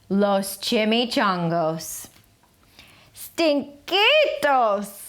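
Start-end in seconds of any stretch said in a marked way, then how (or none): 3.1-5.0 very slow high call. A narrator's reading. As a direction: stretch it out as a call. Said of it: "called out Skippy John Jones".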